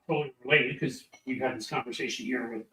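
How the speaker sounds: ordinary delivery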